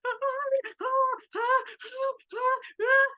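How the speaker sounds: high, loud sobbing ah sounds; high sobbing gasps of a panicked child; panicked crying gasps. Retold or imitated. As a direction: gasp out like a child too upset to talk. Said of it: "she can't even speak it".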